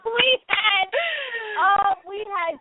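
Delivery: loud, gleeful shout; excited shout. Real, not acted. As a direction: yell it out in triumph. Host celebrating the prank.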